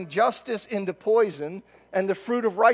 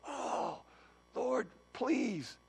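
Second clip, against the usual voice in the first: pleading Amos; 6 semitones higher, 11 dB quieter, much slower than usual. Acted. Amos begging God again.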